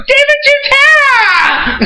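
very high, slow, loud squeal of the name; excited shout. Retold or imitated. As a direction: shriek the name like spotting a star. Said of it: "I was like".

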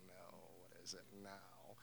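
a soft, resigned groan of what now; weary mother. Retold or imitated. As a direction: sigh it out, dreading the surprise. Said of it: "And she's like".

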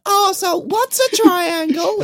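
high, slow naive question; clueless kid voice. Retold or imitated. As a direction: play the dumb innocent kid. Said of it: "the one that's like".

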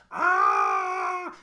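low, loud strangled "Ah!"; mock pained scream. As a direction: cry out like the victim being flayed. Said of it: "your guy's just like".

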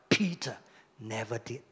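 normal talking voice

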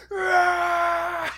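roaring high shout; anime brute. Retold or imitated. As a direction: bellow it out like a brute. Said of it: "shouting his bloody head off".

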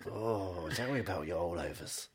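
low, soft sultry murmur. Pretend. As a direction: purr it low and seductive. Mock phone sex line.

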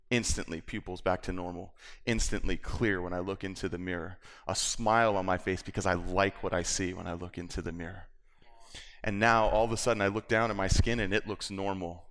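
ordinary delivery

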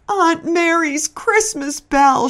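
high, slow, tearful lament; sobbing. A narrator's reading. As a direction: mourn the broken ornament through tears. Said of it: "she sobbed".

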